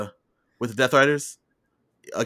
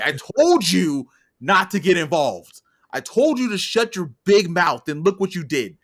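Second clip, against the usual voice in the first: a raised, scolding mock berating, slow at first; hammed-up British commentator telling him off; angry Nigel; 4 semitones higher than usual. Commentator scolds his colleague, a mock angry rant. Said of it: "Nigel is not having it".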